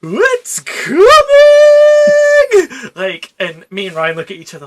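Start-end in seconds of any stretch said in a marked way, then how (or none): 0.0-1.0 drawn-out, high, loud cry of alarm; top of her lungs. Retold or imitated. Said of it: "at the top of her lungs just goes". 1.1-2.9 high, loud held wail; long wailing cry. Retold or imitated. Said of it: "at the top of her lungs just goes".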